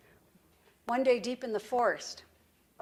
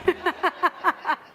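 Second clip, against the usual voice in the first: loud, high run of laughter; hearty laugh; 8 semitones higher, 7 dB louder than usual. Real, not acted. Presenter has just admitted joking to audience, laughing heartily. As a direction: laugh out loud at your own joke.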